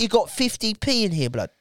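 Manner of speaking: high, incredulous London Jamaican; Jamaican English accent. Retold or imitated. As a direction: question him in Jamaican English slang. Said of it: "that really cool accent".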